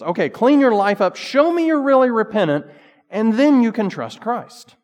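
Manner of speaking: high, loud stern demand; demanding pastor. Retold or imitated. Scottish pastor counsels a sinner to clean up, loud stern demand. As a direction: lay down the law to the sinner.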